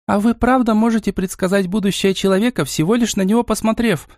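high-pitched, eager question; mildly wide-eyed curiosity; curious. A narrator's reading. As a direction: ask with keen, polite interest. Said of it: "с любопытством спросила Настя".

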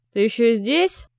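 menacing question, rising at the end; threatening cripple. A narrator's reading. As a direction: drive him out with a threat. Калека гонит Марка ружьём. (угрожающий вопрос)

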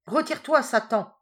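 shouted high and loud; exorcist's cry. A narrator's reading. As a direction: cast the devil out with a shout. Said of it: "s'écria le curé". (le curé crie pour chasser Satan)

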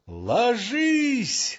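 high, drawn-out shouted order; sentry's yell. A narrator's reading. Checkpoint sentry ordering a stranger down, yelling. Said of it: "заорали от К П П".

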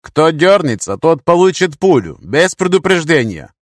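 loud, high warning to everyone. A narrator's reading. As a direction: announce the threat forcefully. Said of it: "честно предупредил".

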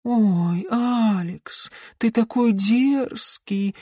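high, slow, coquettish witch; coy cooing. A narrator's reading. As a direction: purr it like a flirt. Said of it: "жеманно проворковала та".

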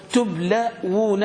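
"تبلون" slowly in parts, tub-laa-wuu-na; slow dictation. Shown as a demonstration. Teacher dictates the word for students to write, drawn out. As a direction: stretch each syllable of "تبلون" for writing. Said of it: "اكتبوها، اكتبوها".

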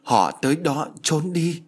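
high-pitched, frightened warning; panicked. Acted. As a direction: urge hiding in rising panic. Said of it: "sắc mặt hoảng loạn".

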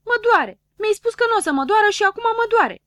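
put-on high, loud complaining little boy; whiny Dunno. Dunno whining to doctor Pilulă that it hurts, high and loud. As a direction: whine at the doctor who lied.

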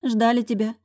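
fond housekeeper. A narrator's reading. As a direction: tell him they waited. Housekeeper talking about kids.

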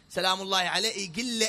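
normal talking voice

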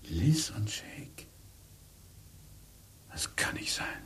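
slow unvoiced whisper of disbelief; husband overhears his wife's affair, whispering in shock; stunned whisper. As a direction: breathe the names in shock.

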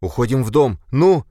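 urgent high panicked cry; panicking. Acted. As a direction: shout it in rising panic. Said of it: "начинающий паниковать". (в панике)

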